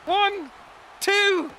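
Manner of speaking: high, loud play-by-play cheering; excited shouting. Real, not acted. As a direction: yell the count like a thrilled fan. Cheering the match finish.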